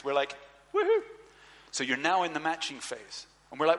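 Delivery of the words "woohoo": a high, loud whoop of joy; high whooping cheer; excited cheer. Retold or imitated. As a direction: celebrate like you just got great news. Cheering at good news.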